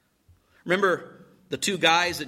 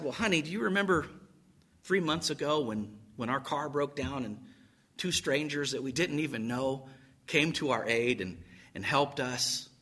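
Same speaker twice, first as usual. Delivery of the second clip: soft, low, coaxing wife; gentle reminder; 4 semitones lower, 8 dB quieter than usual. Acted. Wife reminds husband of strangers' help, gently. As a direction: remind him softly and gently.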